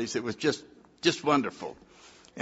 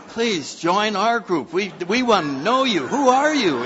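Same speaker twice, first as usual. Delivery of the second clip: eager crowd; 8 semitones higher, 6 dB louder than usual. Retold or imitated. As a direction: beg him to join the group, all excited. Eager crowd voiced, high and loud.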